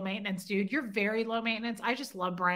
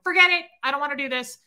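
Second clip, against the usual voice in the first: fed-up outburst; 4 semitones higher, 7 dB louder, faster than usual. Retold or imitated. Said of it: "and I'm like".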